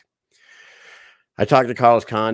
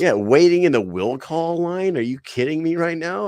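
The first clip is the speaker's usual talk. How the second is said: high, loud disbelief; can't believe it; incredulous; 9 semitones higher, 5 dB louder than usual. Retold or imitated. Incredulous at champ waiting.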